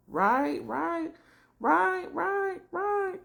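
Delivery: very high, squeaky repeated agreement; high-pitched reaction. Real, not acted. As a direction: squeal each word way up high. High squealed reaction to retold scene.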